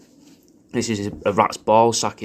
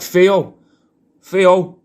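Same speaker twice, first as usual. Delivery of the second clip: loud, raised call of "Theo" with the th turning to F; shouting a dog's name; 8 semitones higher, 8 dB louder than usual. Retold or imitated. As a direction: bellow the name across the park. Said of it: "that just sounds like I'm shouting Theo".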